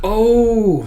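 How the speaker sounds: high, loud drawn-out oh; sudden realization. Acted. Customer realizing what the cook expected, loud high oh.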